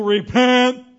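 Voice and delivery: loud high preacher's yell; yelling repent. Retold or imitated. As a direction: bellow it at the crowd like a wild prophet. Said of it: "yelling at people".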